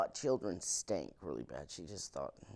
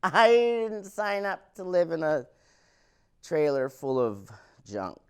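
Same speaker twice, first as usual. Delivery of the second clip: higher, louder mock mom complaining; 7 semitones higher, 10 dB louder than usual. Mom complaining to dad about junk furniture, loud mock whine. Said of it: "My mom's like".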